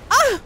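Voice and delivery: shrill pained shriek; sudden high-pitched yelp of pain; high scream. Real, not acted. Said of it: "not a manly scream".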